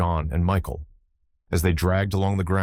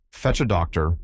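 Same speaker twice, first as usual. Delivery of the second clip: ordinary delivery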